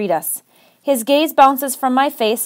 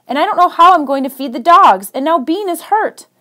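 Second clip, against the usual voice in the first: higher, strained, close to breaking; 5 semitones higher, 4 dB louder than usual. Acted. Secret admits her fear to Chris, voice breaking. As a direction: let the voice crack on the worry. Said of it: "My voice breaks".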